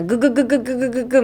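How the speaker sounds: rapid-fire "グ" repeated like a drill; drill-like G sounds. Shown as a demonstration. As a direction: rattle "ググググググググ" from the throat. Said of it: "時の効果音みたいな".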